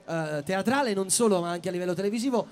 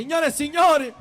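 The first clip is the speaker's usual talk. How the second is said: announcer shout; 6 semitones higher, 6 dB louder than usual. Real, not acted. Announcing the guest to crowd.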